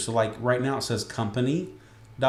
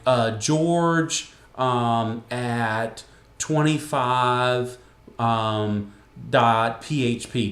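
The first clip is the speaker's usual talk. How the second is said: slow, halting guesses with gaps; drawn-out parody of unsure dictation; imitated struggle to remember; 4 dB louder, much slower than usual. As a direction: grope for each piece, mock-clueless. Relative struggling to recall an address.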